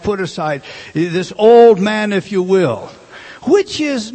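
preaching at full force. Acted. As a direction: drive each phrase home like a revival preacher.

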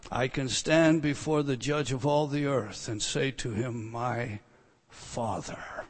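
low, soft, reverent address; hushed awe. Acted. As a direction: let it sink to a near-whisper on the last words. Awed, intimate address to God.

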